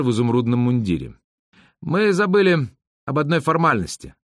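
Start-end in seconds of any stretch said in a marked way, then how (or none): 1.8-4.1 lightly voiced young officer, higher pitch; a higher, official tone for the officer. A narrator's reading. Officer stopping departing princes over a formality, lightly voiced and official.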